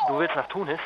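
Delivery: can't believe what you heard; shocked question. Acted. Flo shocked that Carla wants to go to Tunis.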